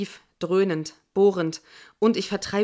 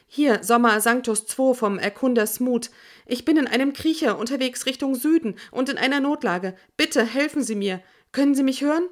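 higher, louder desperate distress call; voice too high; 6 semitones higher, 4 dB louder than usual. Acted. Stranded scout radioing for help, high panicked voice. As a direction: push out a panicked emergency call. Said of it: "meine Stimme viel zu hoch".

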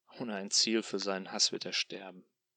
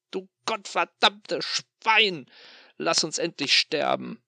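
high, slow, furious scream; desperate scream of rage at the tormentor; 8 semitones higher, 9 dB louder, slower than usual. Acted. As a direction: hurl the curse at him full voice. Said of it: "schreie ich ihn an".

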